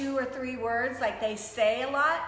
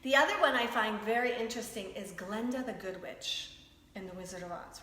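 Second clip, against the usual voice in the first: ordinary delivery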